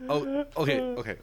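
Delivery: squeaky put-on okay; playful high voice; mock high okay. Mock reaction after laugh.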